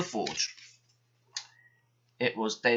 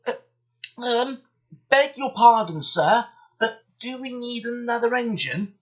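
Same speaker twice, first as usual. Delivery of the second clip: timid high voice; 11 semitones higher, 6 dB louder than usual. A narrator's reading. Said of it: "said Duck quietly at last".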